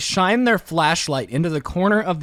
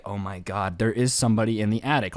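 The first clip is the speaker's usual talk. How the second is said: low, hushed and scared, rushing the second half; scared hushed gasp; 10 semitones lower, 4 dB quieter than usual. Retold or imitated. Visitor realizing someone is upstairs, hushed and frightened. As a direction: breathe it out in sudden fear. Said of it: "they're like".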